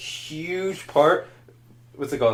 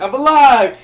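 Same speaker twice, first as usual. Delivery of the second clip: higher, louder mock cheer; voicing Han Solo; 10 semitones higher, 6 dB louder, slower than usual. Retelling the spoiler video, Han's mock cheerful claim. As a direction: chirp it out brightly. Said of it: "he's like".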